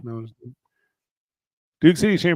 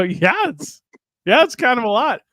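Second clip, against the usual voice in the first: agreeing through a laugh, pitched way up; high, amused, half-laughing yeah; laughing agreement; 9 semitones higher, 5 dB louder than usual. Retold or imitated. Telling his old producer it is a lot, laughing. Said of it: "I go".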